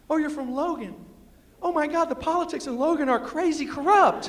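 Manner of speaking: high, loud, gushing excitement; excited. Retold or imitated. Speaker gets excited on hearing someone is from West Virginia, high and excited. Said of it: "I get excited".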